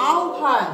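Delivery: আহ্বান said slowly as আওভান, clearly spelled out; teacher slows down to model আহ্বান as আওভান; correct pronunciation. Shown as a demonstration. Teacher to students, modelling আহ্বান slowly. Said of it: "এটার উচ্চারণটা হবে".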